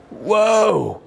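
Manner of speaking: stunned by the shot; amazed whoa. Retold or imitated. Ball stops near the hole, amazed.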